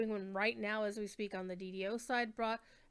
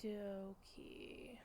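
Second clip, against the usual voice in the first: whispered under the breath; whispering; 15 dB quieter than usual. Real, not acted. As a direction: mutter it to yourself. Muttering while typing in-game.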